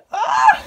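loud, very high squeal; excited scream. Real, not acted. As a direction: shriek with joy. Overjoyed outburst over shirt.

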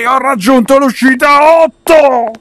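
high, loud, slow victory cry; triumphant shout. Real, not acted. Winning the game.

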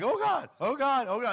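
high, loud panicked cries; hammed-up foxhole prayer; mock panic. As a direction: cry out like bullets are flying. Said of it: "'Cause they're shooting at you".

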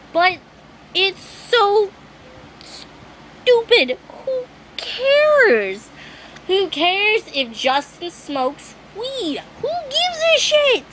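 high, exasperated outburst. Real, not acted. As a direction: throw your hands up, fed up. Peak of exasperated rant.

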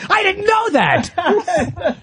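high, drawn-out amazed cry; hammed-up shocked discovery; mock astonishment. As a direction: draw it out in exaggerated disbelief.

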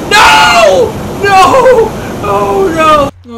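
high desperate screams of no; screaming no. Real, not acted. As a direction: scream in real frustration. Game character dies, screaming.

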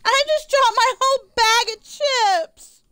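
high, loud dismayed outburst; upset exclamation. Real, not acted. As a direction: blurt it out in shock. Just dropped the chips.

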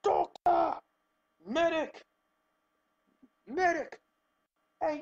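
high urgent calls for a medic; man down shout. Real, not acted. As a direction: yell for help across the map. Calling for medic in game.

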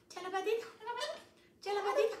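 high, soft coaxing to the bird. Real, not acted. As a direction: coax the parrot sweetly, pitched high. Pide la patita al loro. (voz aguda y suave para el loro)

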